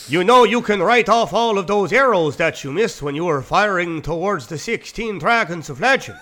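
put-on Skyrim-style Nordic accent, drifting Irish; lightly put-on Swedish accent. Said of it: "Like a fucking Skyrim character".

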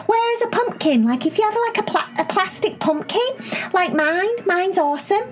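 put-on bubbly, high-pitched little girl; excited high girl voice. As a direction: squeal it out, eager and excited. Cherry voiced, rambling.

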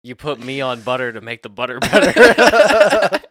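laughing through the joke. Real, not acted. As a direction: chuckle while speaking.

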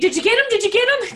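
loud, high frantic repeated question; mock panic. Imitating the brothers stabbing at Crowley, mock frantic. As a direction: exaggerate the panic, pitch and volume up.

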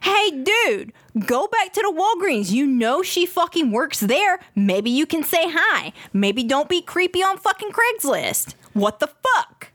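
high-pitched comic scolding; exasperated telling-off. Real, not acted. Host tells the poster to go back to Walgreens, exasperated.

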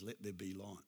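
soft, low, almost breathed command; hushed, reverent declaration; hushed divine command. Acted. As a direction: speak it gently, with quiet authority. God speaks to darkness, hushed.

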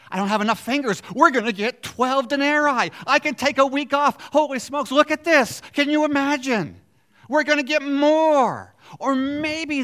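high, loud, gleeful shouting; giddy greed. Acted. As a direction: cry out like workers expecting a windfall. Voicing gleeful workers' greed.

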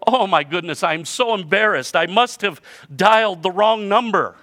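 hammed-up embarrassment at a phone mistake; mortified caller. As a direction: blurt out an embarrassed apology, acted. Story caller realises wrong number.

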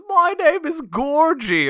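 high, slow, hammed-up bad Cockney; terrible Cockney accent. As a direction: put on a loud, exaggerated fake British accent. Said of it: "puts on the accent and says".

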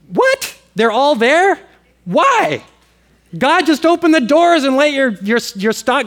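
high, loud astonished questioning; can't believe what he's reading; preacher acting out his own baffled thoughts about the prisoners; incredulous disbelief. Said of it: "And I'm thinking".

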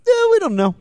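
high, loud shrug of an answer; mock clueless experts. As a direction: own up comically to knowing nothing. Voices the experts' sheepish conclusion.

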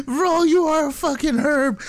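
high voice choked with laughter; laughing taunt. Real, not acted. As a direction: jeer at him while nearly crying laughing. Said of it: "dying laughing".

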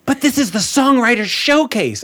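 a hint of an exasperated host; imitating the organizer. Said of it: "And he goes".

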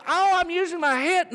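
high-pitched mock protest; put-on high voice of self-excuse; self-justifying shopper. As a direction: pitch it up, imitating someone fooling themselves. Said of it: "You go".

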